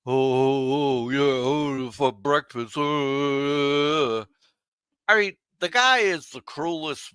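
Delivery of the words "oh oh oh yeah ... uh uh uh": mocking moans. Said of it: "He's mocking her".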